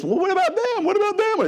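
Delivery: put-on high whiny child voice, far above own; a kid whining about a sibling. Child whining at parent about the other kid, high-pitched whine.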